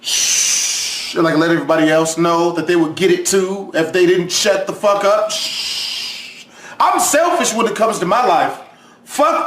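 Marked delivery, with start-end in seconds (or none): 0.0-1.1 voiceless hard shush; mock shush. Hushing the classroom. 5.3-6.4 voiceless hard shush; mock shush. Hushing again.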